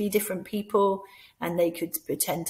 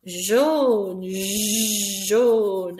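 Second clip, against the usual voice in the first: loud, drawn out jaune; 5 dB louder than usual. Shown as a demonstration. Teacher calling jaune to the class while pointing. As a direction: call jaune, jaune out loud and long. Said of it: "just by pointing".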